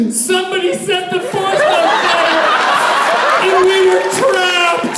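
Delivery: high-pitched hammed-up wail; comic tearful howl; mock crying. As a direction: bawl it out as a fake-crying boy, words stretched. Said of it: "Both started crying".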